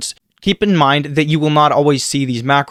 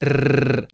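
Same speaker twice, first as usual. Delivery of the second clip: long tongue-trilled rrr; sustained rolling R on blown air; rolled R trill; 4 dB quieter than usual. Shown as a demonstration. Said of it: "becomes a rolled R like this".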